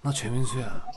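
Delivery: Choi Min-su impression. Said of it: "개인기 내가 한다".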